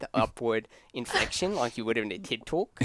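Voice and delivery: put-on Australian with upward inflections; Australian accent. Shown as a demonstration. Host demonstrating on request to friends, Australian accent. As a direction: explain it in a mock Australian TED-talk accent. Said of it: "How's your Australian accent?".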